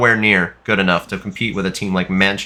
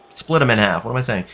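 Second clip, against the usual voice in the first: ordinary talk, nothing marked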